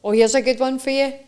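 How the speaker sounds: ordinary talk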